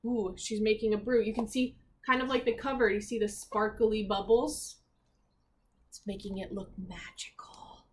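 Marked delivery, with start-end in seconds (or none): 5.9-7.9 softer, hushed whisper. Acted. Reader whispering to the children about the magic brew.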